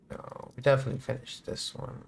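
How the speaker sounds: hushed muttered aside; whispering. Real, not acted. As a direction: whisper it to yourself. Quiet unvoiced aside.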